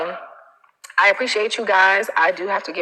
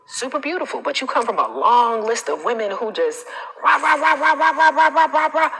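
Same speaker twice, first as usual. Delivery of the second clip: mock bossy chatter; 6 semitones higher than usual. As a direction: parody nagging women, pitched up high. Mocking bossy women's chatter.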